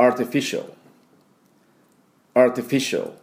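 アーティフィシャル as "ar-ti-fi-shol"; アーティフィシャル, English R and dark L ending; repeat-after-me model. Shown as a demonstration. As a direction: model アーティフィシャル in English with a swallowed last vowel. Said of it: "私の後で発音いきましょ".

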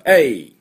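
A as a long gliding "ei", like a throw cry; judo-throw "ei". Shown as a demonstration. Teacher likening it to a judo throw, drawn-out ei. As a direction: let out A as a drawn-out "eeii". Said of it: "柔道で投げ飛ばす時のA".

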